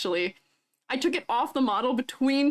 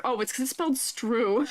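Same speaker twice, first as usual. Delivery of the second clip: usual speaking voice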